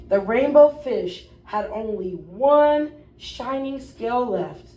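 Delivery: plain reading voice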